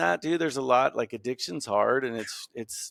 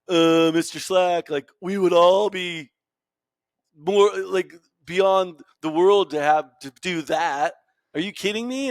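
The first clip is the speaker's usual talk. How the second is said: higher, louder, slow amazed student; awestruck kid; 6 semitones higher, 6 dB louder, slower than usual. Retold or imitated. High school kid telling his teacher he's amazed, higher, louder and slow. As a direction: ham up a kid who can't believe his teacher. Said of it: "one kid was like".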